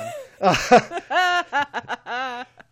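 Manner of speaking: high, loud burst of laughter; long amused laugh; real laugh. Real, not acted. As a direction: crack up at your own pun. Laughs at own accidental pun.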